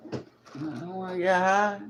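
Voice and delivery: slow, put-on cartoon voice; South Park voice. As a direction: drag it out like the cartoon. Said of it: "Remember that? ... South Park".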